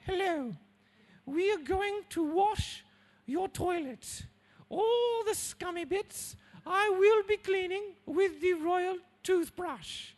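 high, slow, plummy royal voice; the Queen. Retold or imitated. Queen offering to clean your toilet, high posh royal voice. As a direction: put on a grand queenly voice, slow. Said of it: "if the Queen turned up to your house".

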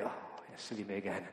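whispered, deflated self-mockery; a husband caught out by his wife, hushed and sheepish; sheepish aside. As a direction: mumble it like a scolded husband.

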